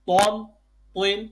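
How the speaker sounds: ordinary talk, nothing marked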